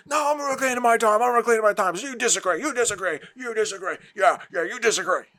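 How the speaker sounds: a mock politician talking over a witness and dismissing her; mocking a politician interrupting. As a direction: bulldoze the answer like a rude congressman. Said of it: "people don't talk like that".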